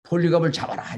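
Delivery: high, loud demand; the mob shouting to seize the old teacher, up high; crowd shout. Retold or imitated. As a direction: yell it like an angry mob. Crowd demanding Polycarp's arrest.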